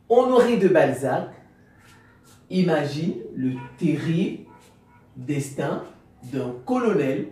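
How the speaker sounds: careful read of a summary; reading aloud. A narrator's reading. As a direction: lay out each phrase for the class to take down. Reads the summary slowly with pauses.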